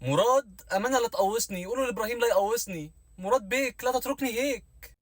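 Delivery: high, pleading soap-opera plea; Turkish drama heroine. Shown as a demonstration. Mocking a dubbed Turkish soap for a friend, pleading high. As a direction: beg Murad like a dubbed Turkish series heroine. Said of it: "بتفرج على مسلسلات تركي".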